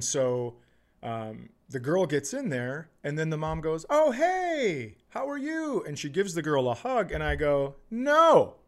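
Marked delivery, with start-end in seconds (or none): 3.9-5.8 high, sing-song mock hello; fake-friendly greeting. Mom greeting the girl she laughed at, fake-friendly. 7.9-8.6 high, loud drawn-out no; indignant objection. Retold or imitated. Woman calling out a mom's fake hug, indignant objection.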